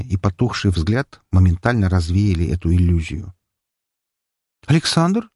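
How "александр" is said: surprised rising question. A narrator's reading. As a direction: caught off guard, she asks. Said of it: "удивилась она". (удивлённо, выше)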